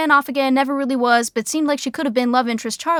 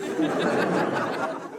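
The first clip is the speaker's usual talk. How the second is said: soft breathy chuckle; 5 semitones higher, 7 dB quieter than usual. Real, not acted. Narrator laughs at own joke.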